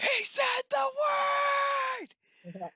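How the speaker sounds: high, loud mock alarm; excited shout. Mock alarm at a big word.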